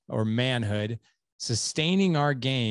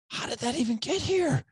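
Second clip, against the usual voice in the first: incredulous. Retold or imitated. Bewildered inner question, bathroom.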